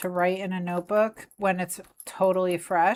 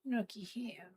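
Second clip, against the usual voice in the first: hushed whisper; 3 semitones higher, 13 dB quieter than usual. Real, not acted. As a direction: breathe it so the cat isn't disturbed. Whispering over the cat.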